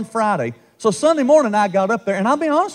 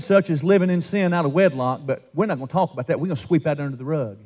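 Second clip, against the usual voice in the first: mock hush-hush; 6 semitones lower, 4 dB quieter than usual. Mocking church hushing up sin.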